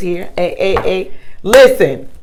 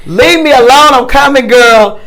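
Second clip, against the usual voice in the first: sassy reply; 7 semitones higher, 8 dB louder than usual. Retold or imitated. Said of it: "And she said".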